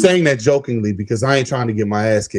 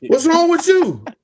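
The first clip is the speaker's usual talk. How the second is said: high, slow voice of a worried wife; mock wife voice; 13 semitones higher than usual. Retelling his girlfriend's phone call, in her high voice. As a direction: put on his lady's voice, a little dragged out.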